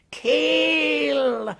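a snake's savage cry to kill; fierce command. Acted. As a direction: bark the order at the snake. Snake strikes, Kaa commands.

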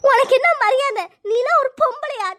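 loud, scornful outburst; angry contempt. Acted. Labouring woman defying her mother-in-law, angry contempt. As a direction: hurl the insult back at her. Insults captor in a quarrel.

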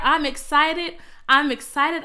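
loud excited declaration. Shown as a demonstration. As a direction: model the excited reply for viewers. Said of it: "Can you just type in there".

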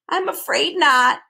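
a touch of sorrow; sad. A narrator's reading. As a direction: admit it sadly. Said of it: "he replied sadly".

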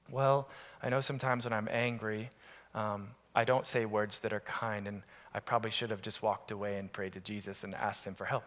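put-on soft, subdued child voice; quiet small answer. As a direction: say it meekly, quietly.

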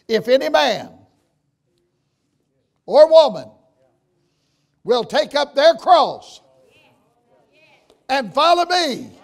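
full-force preaching call; fiery preaching. Acted. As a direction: lift the congregation with the call.

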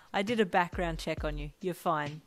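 plain reading voice

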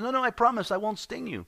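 high, hurried-sounding storyteller's scorpion protest; insistent scorpion. Acted. Scorpion promises frog it will not sting, insistent. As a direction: plead innocence in a high voice.

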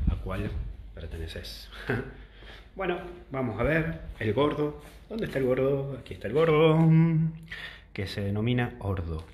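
normal talking voice

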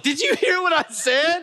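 very high, panicked squeal through a laugh; mock panic. Man asking Jessica in the car about his slip, panicked squeal. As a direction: squeak it out, desperate to know who heard.

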